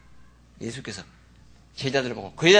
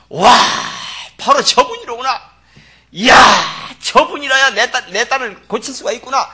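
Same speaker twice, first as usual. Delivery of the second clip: loud, higher, wonder-struck outburst; awed realisation; 6 semitones higher, 10 dB louder than usual. Retold or imitated. Mother seeing Jesus, awed loud realisation. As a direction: she sees her only hope and gasps it out. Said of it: "하고 보인 거예요".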